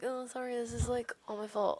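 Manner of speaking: high, soft, apologetic voice, laughing at the end; mock sheepish Roku. Friend mimics Roku apologizing, high, soft and sheepish. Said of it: "he's just kinda like".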